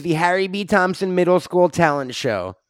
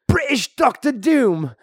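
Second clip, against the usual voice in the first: mock British villain greeting; 4 semitones higher than usual. Greeting his British arch enemy.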